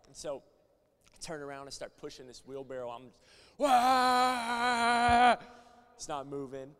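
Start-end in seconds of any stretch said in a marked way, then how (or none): 3.6-5.4 loud, high straining holler; imitated effort yell. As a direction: yell as if shoving with all your might. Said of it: "You gotta yell".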